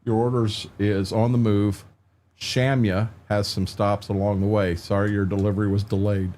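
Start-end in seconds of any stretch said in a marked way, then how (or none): none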